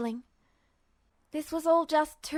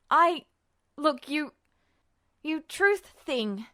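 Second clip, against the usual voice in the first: gasping fear; slower than usual. A narrator's reading. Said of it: "Ed gasped".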